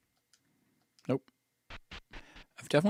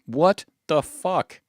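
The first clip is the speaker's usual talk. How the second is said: loud, slow, higher-pitched shock; appalled disbelief; 5 semitones higher, 8 dB louder, much slower than usual. Acted. Companion reacting to a sudden killing, appalled. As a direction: stretch each word out in loud disbelief.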